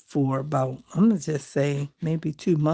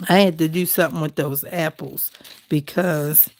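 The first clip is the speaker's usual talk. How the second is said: normal talking voice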